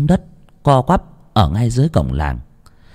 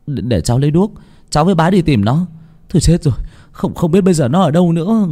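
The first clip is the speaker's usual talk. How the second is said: put-on higher-pitched child rushing to help; hurried and urgent; 7 semitones higher, faster than usual. A narrator's reading. Worried child offers to go searching, hurried and urgent. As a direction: blurt it out in an urgent rush, pitched up. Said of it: "Cô bé cũng vội vã nói".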